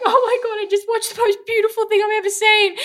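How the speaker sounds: very high, squeaky mock-weepy wail; hammed-up tearful squeal; imitating crying. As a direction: act out blubbering over something beautiful.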